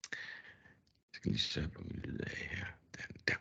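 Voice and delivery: hushed whisper, almost no voice; whispered aside. Real, not acted. Presenter whispering to himself while fixing the sound, hushed. Quick muted aside during demo.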